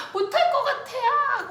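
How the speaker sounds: put-on high, loud, drawn-out child's wail; re-enacted panicked cry, stretched out; mock crying wail. Child in the lowering dentist chair, mock crying. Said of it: "막 우는 거예요".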